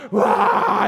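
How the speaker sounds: high loud burst of a wail; mock outburst. Shown as a demonstration. Preacher showing how a hidden feeling bursts out, sudden loud cry. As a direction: blurt out a sudden exploding cry.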